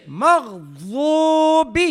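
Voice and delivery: مغضوب very slow, heavy ض and long oo; correct dad. Shown as a demonstration. Teacher modelling for students, drawn-out heavy ض in مغضوب. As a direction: chant مغضوب with a thick dad. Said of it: "یہ تیسری آواز ہے".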